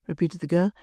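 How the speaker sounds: ordinary delivery, nothing marked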